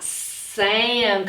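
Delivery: ordinary talk, nothing marked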